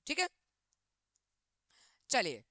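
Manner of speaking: ordinary talk, nothing marked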